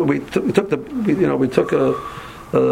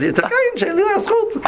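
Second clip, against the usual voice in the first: high, fast, laughing comeback; laughing retort; much faster than usual. Retold or imitated. Teasing the taxi driver with a high, laughing quip. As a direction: toss it back with a giggle.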